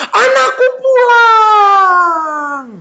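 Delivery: high, long drawn-out cry; joyful shout. Acted. Father sees his son coming home, shouting. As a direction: holler it out, stretching the last word. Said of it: "bapaknya teriak".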